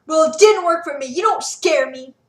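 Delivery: loud defiant yell; yelled back. A narrator's reading. Sarah yelling back at Quintus, defiant. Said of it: "she yelled back at him".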